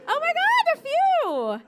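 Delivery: high, loud mock squeal; excited shriek. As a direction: shriek with mock delight at the tourists. Spotting tourists in audience.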